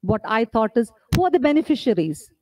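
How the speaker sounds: normal talking voice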